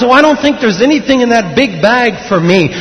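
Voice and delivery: high, lightly voiced doubtful girl; a hint of a wistful film heroine; Dorothy. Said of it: "like Dorothy and the Wizard of Oz".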